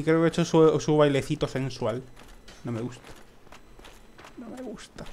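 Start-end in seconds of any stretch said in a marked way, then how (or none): 2.6-3.0 low-key "No me gusta" under the breath; just mutter it; quiet plain mutter. Real, not acted. Repeats phrase, first take. 4.4-5.0 tiny high distant "No me gusta", much softer; squeaky mock voice. Repeats phrase in squeaky voice.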